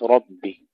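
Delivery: ربي with a full, heavy emphatic R. Shown as a demonstration. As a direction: say ربي with the R thick and velarized. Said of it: "ننتبه أولًا إلى تفخيم الراء في كلمة ربي". (راء مفخمة في ربي)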